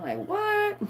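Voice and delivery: incredulous what. Real, not acted. Reacting to the fire gun, incredulous high squeak. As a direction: squeak out disbelief. Disbelief at flamethrower.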